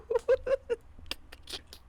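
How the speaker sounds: high squealing giggle; shrill burst of laughter; laughing. Real, not acted. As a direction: crack up helplessly. Laughing at his own slip.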